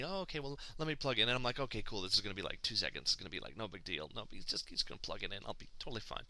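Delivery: hushed, under the breath, trying to stay calm; nervous inner mutter. Retold or imitated. Selling an Xbox to a clerk, hushed nervous mutter. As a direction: mutter to yourself that it'll be fine.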